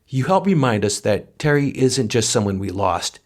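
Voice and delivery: ordinary delivery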